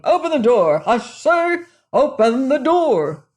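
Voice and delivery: loud, high furious shout; angry shout. A narrator's reading. Angry man outside shouting at the locked door. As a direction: bellow through the door to be let in. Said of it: "came the voice again".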